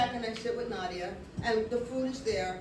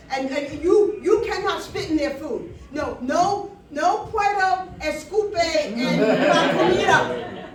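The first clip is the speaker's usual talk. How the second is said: loud, insistent telling-off; stammering confrontation; 3 semitones higher, 9 dB louder, slower than usual. Retold or imitated. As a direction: push it out louder, word by word. Confronting the waiter, acted out.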